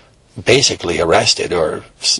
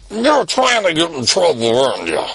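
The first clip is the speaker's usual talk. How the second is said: accusing dad; 7 semitones higher than usual. Acted.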